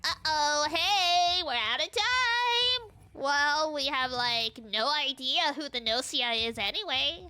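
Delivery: put-on Valley Girl voice. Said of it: "I gotta give her the Valley Girl voice".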